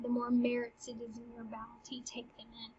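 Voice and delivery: whispered paraphrase. Acted. Paraphrasing Hamlet, voice drops.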